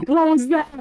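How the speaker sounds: shout. Acted. DM voices NPC yelling.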